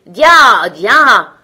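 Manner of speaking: loud, drawn-out carter's cry; big repeated shout to a horse; shouted commands. A narrator's reading. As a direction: holler it out to drive a horse. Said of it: "tandis que le petit criait".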